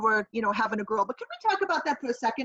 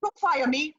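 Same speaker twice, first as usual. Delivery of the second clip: high panicked no; pleading; 4 semitones higher, slower than usual. Pretend. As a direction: beg not to be fired. Pretend fired employee pleading.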